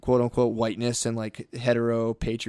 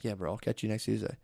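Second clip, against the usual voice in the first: offhand and much softer, easygoing; relaxed mentor waving a student off, much softer; casual soft brush-off; 5 semitones lower, 11 dB quieter than usual. Retold or imitated. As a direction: toss it off lightly to a kid. Casual reply to kid.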